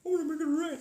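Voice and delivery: high, singsong "I wanna make it rain"; mock self-quote. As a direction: chirp it up high like a silly wish. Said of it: "It's on YouTube".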